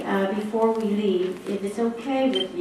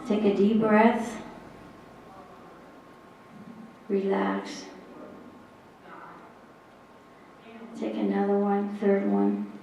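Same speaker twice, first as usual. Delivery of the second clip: guided breathing. Acted. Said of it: "I will guide you through this".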